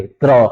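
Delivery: क्र read as one quick cluster, k and r fused; reading demo. Shown as a demonstration. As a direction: say क्र fast, the r glued onto क. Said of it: "इसे क्या पढ़ेंगे". (क्र को एक साथ तुरंत बोलें)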